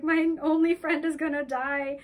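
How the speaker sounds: mock-panicked worry for a friend; freaking out. Girl frets as her friend nears the surface, mildly acted panic. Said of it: "She's freaking out".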